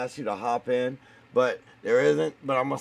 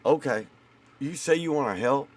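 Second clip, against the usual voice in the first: usual speaking voice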